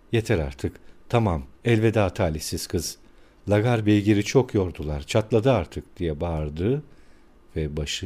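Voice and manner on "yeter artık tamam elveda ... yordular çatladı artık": ordinary delivery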